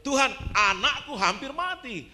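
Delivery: loud, high, drawn-out cry for help; acted father crying out for his dying child; desperate plea. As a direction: beg urgently at the top of your voice. Acts Jairus pleading with Jesus.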